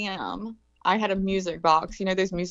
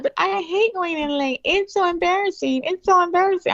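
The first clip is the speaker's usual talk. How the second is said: put-on whiny kid; 12 semitones higher than usual. Kids complaining to mom about being late, high whine. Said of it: "they're like".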